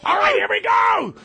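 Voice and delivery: loud, high grandpa yell; Pop-Pop shouting. Retold or imitated. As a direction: bellow it like a gruff grandpa host. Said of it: "said Pop-Pop".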